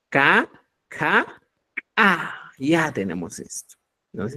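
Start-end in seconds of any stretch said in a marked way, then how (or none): 1.9-2.5 A begun with a popped ejective k; glottalized k. Shown as a demonstration. Teacher contrasting phonemes, glottalized A. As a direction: click the k in the throat into A. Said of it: "el glotalizado es".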